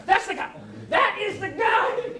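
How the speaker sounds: high, loud and overjoyed; excited recognition. Retold or imitated. Woman recognising the speaker, shouting with excitement. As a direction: cry out as you spot him. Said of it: "She said it like seven times".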